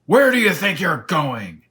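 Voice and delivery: loud, high demand; menacing lion. Acted. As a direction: challenge him, loud and high. Lion threatening the intruder.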